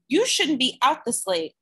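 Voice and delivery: put-on mild bossy older sister; a hint of a stern big sister. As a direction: scold the little brother gently. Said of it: "in her big sister tone of voice".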